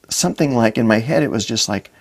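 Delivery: normal talking voice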